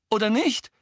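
high, rising, uncertain question; unsure. A narrator's reading. Ron looking to Harry for confirmation, uncertain. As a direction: tack it on, seeking reassurance. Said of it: "fügte er unsicher hinzu".

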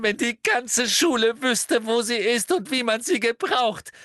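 put-on very high, loud girl's tirade; hysterical outburst. A narrator's reading. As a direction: rant shrilly, building to a squeal. Hermine's tearful tirade at Umbridge.

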